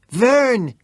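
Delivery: a high, loud, breathless shout; excited shout. Acted. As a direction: shout your name, winded. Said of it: "he shouted, excited and out of breath".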